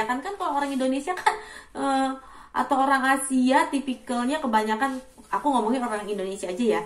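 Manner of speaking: ordinary delivery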